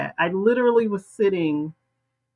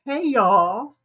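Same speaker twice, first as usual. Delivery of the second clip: ordinary talk, nothing marked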